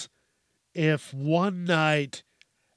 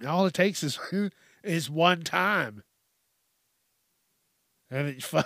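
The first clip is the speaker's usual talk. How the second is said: ordinary delivery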